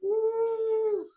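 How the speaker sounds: high, loud, long "woo"; sustained high-pitched mock crowd whoop; crowd cheer. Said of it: "everyone's like".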